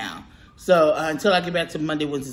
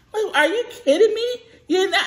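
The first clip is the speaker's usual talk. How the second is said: much higher, mocking the worker's outrage; mock indignant worker; 14 semitones higher than usual. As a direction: squeak it out like the offended cashier. Mimics worker's indignant reply.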